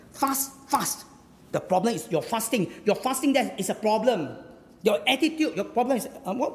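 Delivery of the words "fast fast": "fast", said twice as separate high beats; emphatic repetition. Shown as a demonstration. Preacher showing Hebrew emphasis, emphatic repetition of "fast". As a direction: give each "fast" its own stressed beat. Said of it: "is emphatic".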